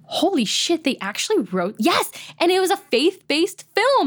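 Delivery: 3 gasp it out like you can't believe it; 1 shocked disbelief. Retold or imitated. Shocked reaction to the audition.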